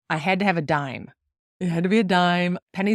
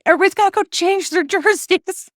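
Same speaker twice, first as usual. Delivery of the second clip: high, loud laughing shout to the family; rally everyone with a half-laughing yell; 12 semitones higher, 6 dB louder than usual. Retold or imitated. Mom yelling at family during a losing game, laughing high shout. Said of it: "I yell at everybody".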